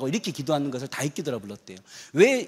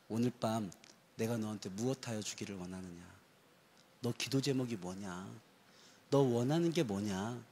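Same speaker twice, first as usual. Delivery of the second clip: gentle Jesus; 5 semitones lower, 8 dB quieter than usual. Retold or imitated. Jesus asks the congregation gently.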